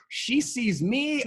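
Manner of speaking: usual speaking voice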